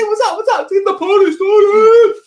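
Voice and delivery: high, loud party-bro shout; hammed-up hype greeting, way up high; mock party bro. Host imitating Bruce hyping the chat, shouting high.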